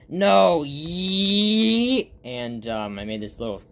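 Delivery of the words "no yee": loud, high, drawn-out squeal; cartoon cry. Reacting to the sledding plan, loud high cartoon cry. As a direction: let it rise into a long shrieked vowel.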